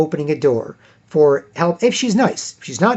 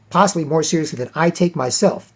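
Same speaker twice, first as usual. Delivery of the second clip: ordinary delivery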